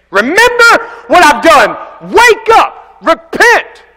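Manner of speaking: high, loud slow warning; stern warning. Retold or imitated. Jesus warning the church of Sardis, high loud stern call. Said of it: "warned very sternly".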